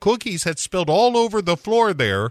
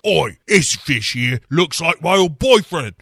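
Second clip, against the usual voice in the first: mock Cockney; 4 semitones higher, 4 dB louder than usual. [name] staring at the aquarium, mock Cockney. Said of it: "she goes".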